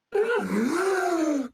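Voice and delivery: loud, high distorted gasp. Acted. Robot player voices his character rebooting, a weird distorted gasp. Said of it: "really weird, distorted noise he makes".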